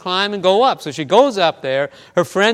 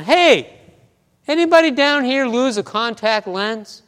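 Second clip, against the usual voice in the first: higher, louder imitated call; yelling down; 6 semitones higher, 4 dB louder than usual. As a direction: call out to climbers below, acting it out. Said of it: "yells down".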